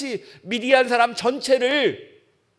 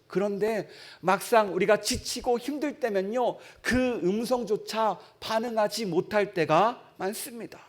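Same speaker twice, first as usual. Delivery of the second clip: ordinary talk, nothing marked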